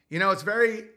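usual speaking voice

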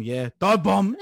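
re-enacted hyped shout; excited mock yell. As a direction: yell it like a streamer mid-race. Acting out his racing stream outburst.

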